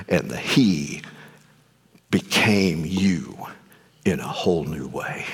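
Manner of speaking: slow, low and soft preaching; hushed solemn testimony. Real, not acted. Preacher recalls being comforted in a dark time, hushed and solemn. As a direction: let it sink low and quiet, with weighty pauses.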